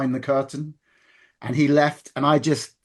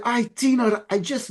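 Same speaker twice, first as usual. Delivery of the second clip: higher-pitched imitation Scottish accent; 7 semitones higher than usual. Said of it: "you do your Scottish accent".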